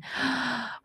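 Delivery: quick startled intake of breath; a gasp of sudden realization; sharp gasp. Real, not acted. Mom gasps as she realizes her daughter's choice.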